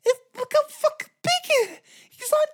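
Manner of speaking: very high, strained disbelief; shocked rider. Retold or imitated. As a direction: shriek it like your eyes can't believe it. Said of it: "You're just like".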